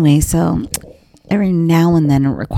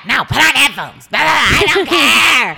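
retold yelling match; 5 semitones higher than usual. Two housemates bickering over headphones, imitated yelling. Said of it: "And we yell at each other".

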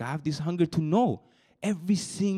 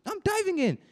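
much higher, rising to a fervent cry; excited declaration; 10 semitones higher than usual. Real, not acted. Preacher telling the youth group he dives in, excited and high. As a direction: throw yourself in with fervent excitement.

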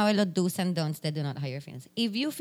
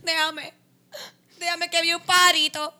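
high, wailing mock sob; mock crying; 7 semitones higher, 5 dB louder than usual. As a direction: ham up a tearful breakdown. Mock crying over bird.

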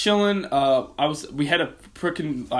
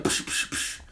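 radio static; 6 dB quieter, slower than usual. Retold or imitated. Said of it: "And I was like".

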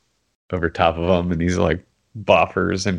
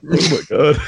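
amazed laugh; 8 semitones higher than usual. Retold or imitated. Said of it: "And I was like".

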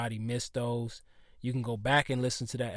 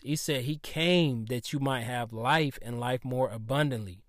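ordinary talk

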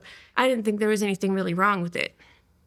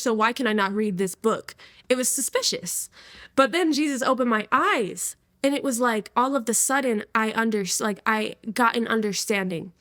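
ordinary talk, nothing marked

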